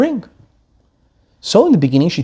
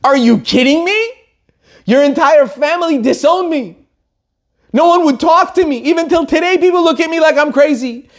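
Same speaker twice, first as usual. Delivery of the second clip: high, outraged complaint; beside herself; 9 semitones higher than usual. Retold or imitated. Wife berating her husband over the lost ring, outraged. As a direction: let the hurt and anger pour out. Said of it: "The wife was besides herself".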